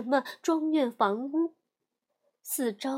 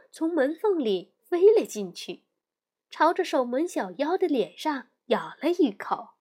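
ordinary delivery

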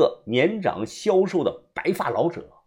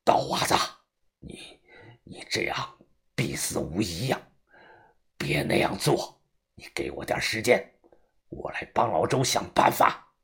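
low, quiet, halting warning; word by word; 7 semitones lower, 6 dB quieter than usual. Acted. Old boss warning the follower off, word by word. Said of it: "一字一句的说道".

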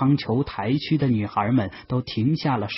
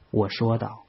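usual speaking voice

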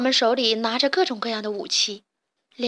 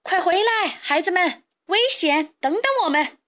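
high-pitched calling after them; urgent shout; 7 semitones higher, 4 dB louder than usual. A narrator's reading. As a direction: call the youngsters back from danger. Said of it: "只好大声地喊道".

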